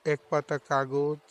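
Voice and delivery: measured poetry reading with long pauses between lines; poem recitation. Acted. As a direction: recite it as a poem, each phrase set apart. Recites own poem after introduction.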